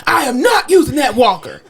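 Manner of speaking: loud, emphatic refusal; imitating stubborn patient. Patient refusing the walker, mock stubborn. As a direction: dig in, punching the words. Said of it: "This man immediately says".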